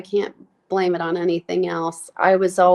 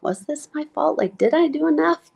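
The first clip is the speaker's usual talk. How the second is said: anxious self-doubt; 9 semitones higher than usual. Retold or imitated. Voicing her own guilt.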